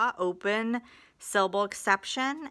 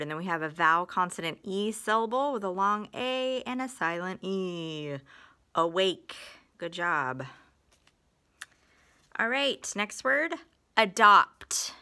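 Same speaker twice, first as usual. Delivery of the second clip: ordinary talk, nothing marked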